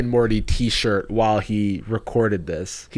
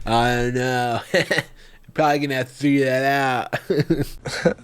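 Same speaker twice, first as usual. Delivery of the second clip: mocking the guy. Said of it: "this guy".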